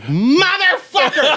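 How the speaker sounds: high, slow drawn-out laughing curse; mock outburst. Comedian to heckler kid, laughing slow mock curse. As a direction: stretch it out in mock outrage.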